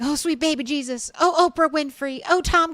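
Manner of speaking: high, loud frantic praying; mock panicked plea. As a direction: beg wildly for help while on fire. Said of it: "he's like".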